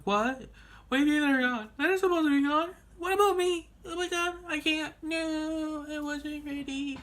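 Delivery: put-on panicked kid. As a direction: squeal it out like a kid left behind, rising in panic. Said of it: "I'm like".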